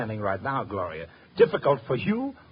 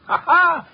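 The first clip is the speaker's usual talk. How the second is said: loud, high burst of triumphant laughter; whooping laugh of victory; delighted laugh; 9 dB louder than usual. Acted. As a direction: bark out a gleeful ha ha. Sidekick cheers solved case.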